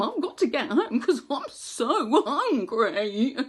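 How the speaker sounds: high laughing pig voice; excited pig voice. Acted. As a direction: babble like a lost hungry piglet. Pig character speaks.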